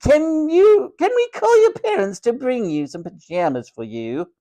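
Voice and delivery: high-pitched, imperious matron question; haughty matron. A narrator's reading. Matron asking Tom to call his parents, high and haughty.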